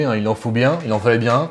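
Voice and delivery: ordinary delivery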